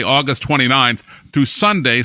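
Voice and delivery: usual speaking voice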